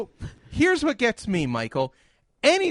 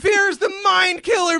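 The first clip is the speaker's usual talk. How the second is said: emphatic quote; 6 semitones higher, 3 dB louder than usual. Real, not acted. Quoting Dune emphatically.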